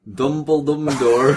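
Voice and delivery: drawn out, slow and laughing; giggling while reading Dumbledore's entrance; laughing through the name. Real, not acted.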